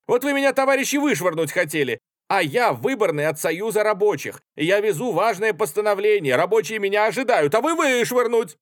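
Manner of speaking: high, loud, agitated self-defence; heated protest. A narrator's reading. Artskhanov tells soldiers he is a worker too, indignant. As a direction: argue urgently, pitch staying high.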